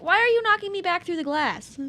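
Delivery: loud, high protest falling at the end; exasperated complaint. Real, not acted. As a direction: demand an explanation from the game. Protests being knocked back.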